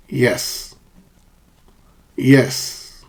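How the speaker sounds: "Yes" falling in agreement; settled, falling "Yes"; downward intonation. Shown as a demonstration. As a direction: let each "Yes" drop at the end. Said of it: "we use a downward intonation".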